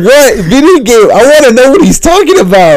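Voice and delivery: worked-up, defensive protest; getting mad. Real, not acted. Defending himself to teasing friends, getting mad. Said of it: "He's just getting mad".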